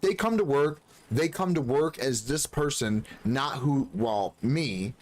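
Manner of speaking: usual speaking voice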